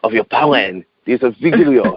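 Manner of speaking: light French accent, mildly put on; imitating his French uncle with a light accent; uncle's French accent. Nephew quoting his French uncle in a light French accent. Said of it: "I'll talk with my uncle's, uh, French accent".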